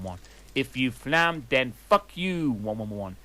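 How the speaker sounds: ordinary talk, nothing marked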